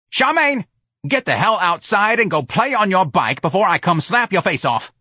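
loud, high furious yell; angry yelling. A narrator's reading. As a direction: scream at the kid to get out. Yelling at grandchild again.

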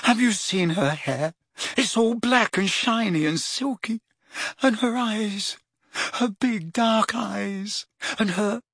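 put-on a lovestruck teen rhapsodising; dreamy rapture. A narrator's reading. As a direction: gush about her dreamily. Ron rhapsodising.

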